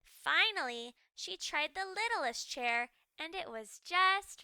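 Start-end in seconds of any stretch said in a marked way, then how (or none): none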